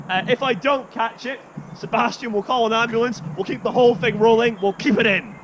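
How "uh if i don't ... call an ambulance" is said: ordinary delivery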